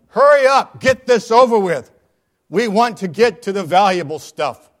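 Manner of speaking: high, loud impatient shout; impatient yell. Acted. Bidder yelling at the auctioneer to hurry up. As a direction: hurry them along with a yell. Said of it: "Someone else yelled".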